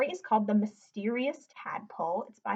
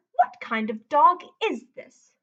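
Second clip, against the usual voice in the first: loud, slow questioning trainer; suspicious trainer; 4 semitones higher, 7 dB louder, slower than usual. A narrator's reading. Trainer questioning Lewis about his odd dog, loud and slow. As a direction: demand an answer slowly. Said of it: "said the trainer".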